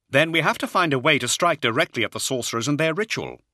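determined in-character statement; declaring. Acted. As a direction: announce it with firm urgency. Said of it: "he declared".

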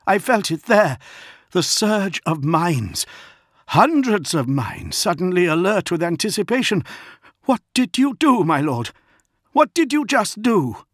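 high, urgent outburst; alarmed accusation. A narrator's reading. Prato confronts the lion over what he felt, high and alarmed. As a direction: build from dread to a high, loud challenge.

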